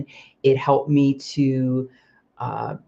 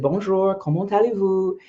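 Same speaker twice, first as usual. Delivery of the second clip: put-on higher, careful French accent on Bonjour, comment allez-vous; good French accent; 5 semitones higher than usual. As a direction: greet like a local in French. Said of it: "your accent was so good".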